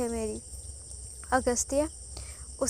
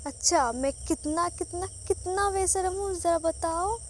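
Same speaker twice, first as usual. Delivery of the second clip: playful teasing; 6 semitones higher than usual. A narrator's reading. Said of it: "मुस्कुराता है और कहता है".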